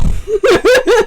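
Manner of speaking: high, loud laugh; real burst of laughter. Real, not acted. Streamer cracking up after an impression, real laugh. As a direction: burst out laughing at your own act.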